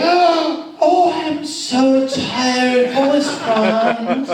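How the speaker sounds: high, loud, very slow weary sigh; hammed-up tired mother. Mother angling for Franz off the plane, loud slow mock weariness. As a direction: play it up as a fake-tired old mother. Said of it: "and Mother would say".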